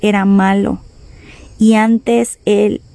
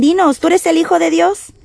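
raised pitch, rising into the question; interrogating accusers; 8 semitones higher than usual. Acted. Tribunal questioning Jesus, demanding. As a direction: challenge the accused to answer. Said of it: "en esta tribunal y le dicen".

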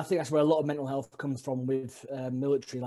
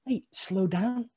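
drawn-out coworker plea; 7 semitones higher, much slower than usual. Retold or imitated. Coworkers telling him to ease off.

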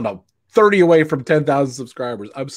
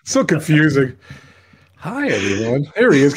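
ordinary talk, nothing marked

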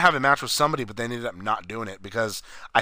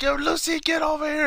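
Ricky. Retold or imitated. Ricky calls Lucy from the window, high mock yell. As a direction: imitate calling out through a window, pitched way up. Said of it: "he was yelling at the window".

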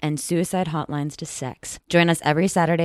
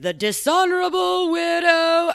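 booming herald proclamation; slow, high proclaiming town crier; 12 semitones higher, 4 dB louder, much slower than usual. Acted. Promo opens with a herald's proclamation.

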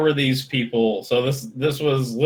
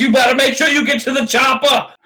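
put-on higher, loud shouted Austrian accent; Schwarzenegger shout; 13 semitones higher, 7 dB louder than usual. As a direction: bellow it like an action hero in an Austrian accent.

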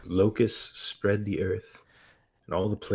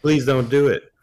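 high, loud desperate plea; loud begging; 6 semitones higher, 9 dB louder than usual. Retold or imitated. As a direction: beg him loudly. Acted quote, brother begging.

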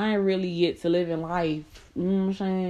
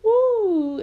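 woo; 5 dB louder than usual. Real, not acted.

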